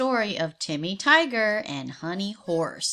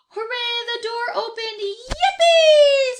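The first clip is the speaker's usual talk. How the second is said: much higher, building to a loud yippee shout; joyful cheer; 12 semitones higher, 9 dB louder, slower than usual. Acted. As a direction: shriek with delight as the door opens. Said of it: "said Timmy".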